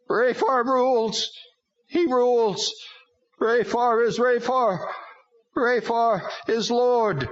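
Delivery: high, slow strange demon voice; proclaiming demon. A narrator's reading.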